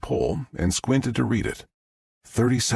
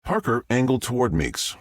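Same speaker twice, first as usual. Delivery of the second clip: ordinary delivery, nothing marked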